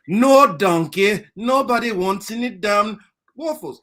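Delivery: put-on loud, high, drawn-out ogre accent; hammed-up irritated cartoon ogre; Shrek. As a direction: refuse loudly, stretching the words. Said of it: "the Shrek accent".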